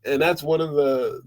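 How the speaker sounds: ordinary talk, nothing marked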